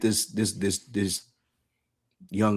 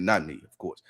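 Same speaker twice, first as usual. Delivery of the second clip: ordinary delivery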